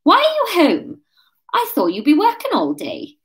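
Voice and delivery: put-on high, accented challenge. A narrator's reading. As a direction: turn the questioning back on him. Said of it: "decided to take up the offensive".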